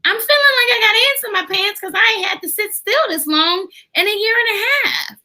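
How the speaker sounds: put-on high child voice, rushing the end; restless kid. Therapist voicing a restless schoolkid, high and hurried. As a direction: blurt it like a fidgety child.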